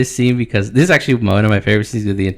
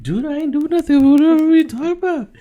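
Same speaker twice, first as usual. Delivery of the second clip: high, put-on dude voice, rushing; nervous denial. Sir Strong to Daemon, squeaky high nervous denial. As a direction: play innocent in a squeaky high voice.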